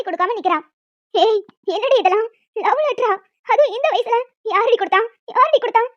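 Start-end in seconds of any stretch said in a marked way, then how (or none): 1.1-5.0 louder, rising fury at her daughter; mother yells at her daughter over the letter; furious mother demanding a name; angry scolding. Acted. 5.4-5.8 Yaaradi shouted higher and louder; mother screams the question a second time; louder angry demand. Acted.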